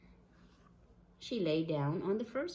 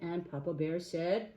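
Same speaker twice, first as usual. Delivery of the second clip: plain reading voice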